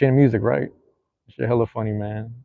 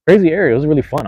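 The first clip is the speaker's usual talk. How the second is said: excited; 7 semitones higher, 8 dB louder, faster than usual. Real, not acted. Recalling wrestling era excitedly.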